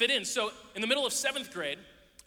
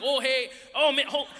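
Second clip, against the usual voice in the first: put-on Wisconsin accent; 4 semitones higher, 6 dB louder than usual. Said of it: "I'm, like, used to this".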